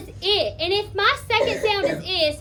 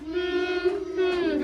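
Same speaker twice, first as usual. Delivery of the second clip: two hummed syllables; wordless demonstration hum; humming the word; 4 dB quieter than usual. Shown as a demonstration. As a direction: hum the beats, mouth closed. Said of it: "Hum it".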